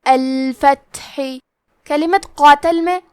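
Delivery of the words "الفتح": "الفتح" with the ta breathed out, slow; breathy ta. Shown as a demonstration. Teacher shows the breathy ta, slow. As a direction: let the air escape on the ta. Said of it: "تو ہم یہ کلمہ کو ایسے پڑھیں گے".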